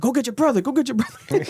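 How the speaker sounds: high-pitched imitation of taunting streamers; mock taunt. Mimics streamers taunting the brother.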